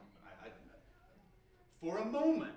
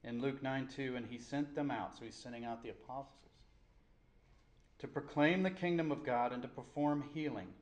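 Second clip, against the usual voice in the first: loud, projected Bible reading; emphatic preacher reading aloud; scripture reading; 9 dB louder than usual. Real, not acted. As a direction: proclaim the verses firmly to a congregation. Reads scripture to the congregation.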